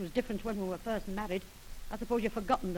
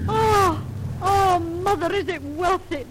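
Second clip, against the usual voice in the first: high, loud frightened crying out; terrified wail; 10 semitones higher, 11 dB louder, slower than usual. Acted. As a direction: wail in fear with a sob. Wife terrified in haunted room.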